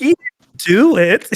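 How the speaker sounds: high, slow mock protest; defensive dad voice. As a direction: whine it like an offended dad. Son quoting a defensive dad.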